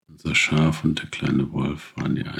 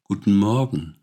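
calling back; 6 semitones higher, 5 dB louder than usual. Acted. The two answer the stork.

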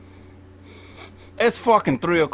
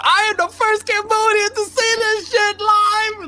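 very high-pitched mock celebration; over-the-top high excitement; excited boast. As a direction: squeal it out like a triumphant first witness. Imagined reaction recorded on his phone.

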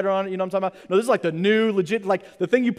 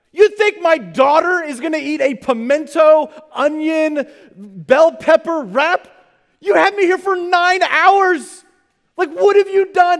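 much higher, louder, slow outraged rant; 9 semitones higher, 6 dB louder, slower than usual. Retold or imitated. Father raging at the airline over his daughter's food, loud and slow. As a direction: thunder at the airline for the child. Dad rants about veggie wrap.

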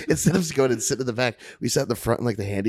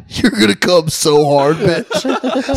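cocky shout; 6 semitones higher, 9 dB louder, much slower than usual. Retold or imitated.

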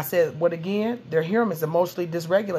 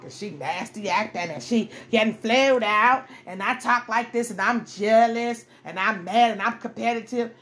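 a hint of a catty, jealous rival; mildly mocking jealous woman voice; 3 semitones higher than usual. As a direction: voice the nasty other woman, hammed a little.

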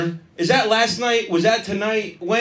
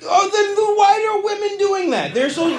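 mock exasperation; 13 semitones higher than usual. Mock complaint about women.

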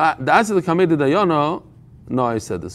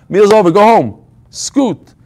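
louder mock brush-off, shooing a guest out; hammed-up impatient hostess, louder; impatient dismissal; 2 semitones higher, 4 dB louder than usual. Acted hostess sends guest off.

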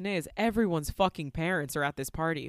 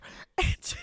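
breathless high squeal of laughter; high giggle. Real, not acted. Laughing at the cursed parents, high giggle. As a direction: burst out laughing.

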